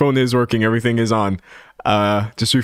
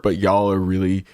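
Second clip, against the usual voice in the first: usual speaking voice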